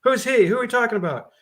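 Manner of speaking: urgent question. Acted. Officer questions frightened women.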